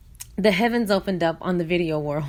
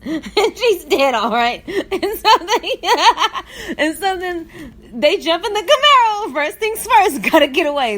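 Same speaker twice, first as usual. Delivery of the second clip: high, louder, breaking with laughter; laughing while telling; 9 semitones higher, 5 dB louder than usual. Real, not acted. Telling the listener the story, can't stop laughing. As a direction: barely get the words out through laughter.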